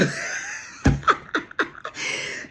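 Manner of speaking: high, airy wheeze of laughter; breathless laugh. Real, not acted. Reader wheezing with laughter at the reading.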